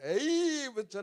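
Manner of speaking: drawn-out, mock-exasperated "A"; exasperated grumble. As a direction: stretch the "A" like an annoyed driver. Acts drivers' reflex grumble at traffic.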